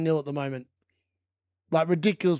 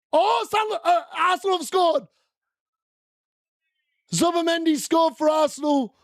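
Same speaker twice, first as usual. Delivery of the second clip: high, raised voice at the goal news; sudden excited update; 10 semitones higher than usual. Real, not acted. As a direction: burst out with the surprise goal. Arsenal goal surprise.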